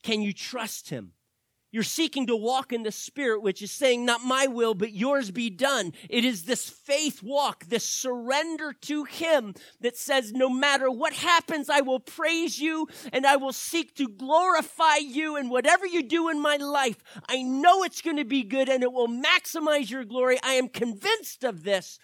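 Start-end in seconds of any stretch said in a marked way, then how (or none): 1.7-10.3 loud, high, impassioned sermon cry; rising shouted declaration of surrender; full-force preaching. Acted. 10.3-11.7 loud high cry of faith; shouting the prayer of surrender; shouted prayer. Acted. 11.7-22.0 loud, high, impassioned sermon cry; rising shouted declaration of surrender; full-force preaching. Acted.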